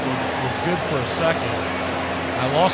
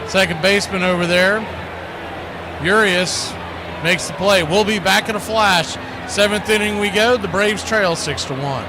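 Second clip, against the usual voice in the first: lively, louder ballgame call; play-by-play call; 8 semitones higher, 4 dB louder than usual. Acted. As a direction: call the action for listeners at home.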